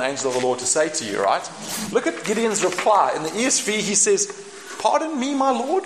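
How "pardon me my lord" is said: higher, questioning mock-meek voice; timid Gideon. Gideon answering the angel, meekly puzzled. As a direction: sound small and baffled.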